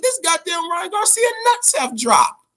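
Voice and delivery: excited exclamation. Retold or imitated. Fan praising Ryan Garcia's nerve, high excited shout. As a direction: hype him up like you can't believe it. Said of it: "I said".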